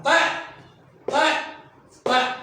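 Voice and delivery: طاء said three times with a heavy emphatic ṭ; repeated letter drill. Shown as a demonstration. A teacher drills the letter name for the class, steady and deliberate. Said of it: "انتبه عليه شلون أقراها".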